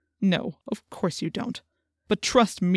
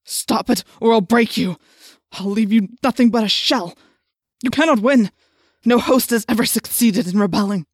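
loud menacing villain threat; furious threat; 6 dB louder than usual. A narrator's reading. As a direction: crush him with your voice. Yeerk threatens host.

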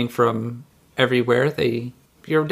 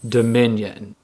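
booming voice; 3 semitones lower than usual. Acted. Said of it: "You hear a voice repeat".

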